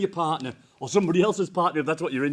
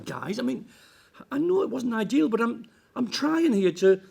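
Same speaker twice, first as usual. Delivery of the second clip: ordinary talk, nothing marked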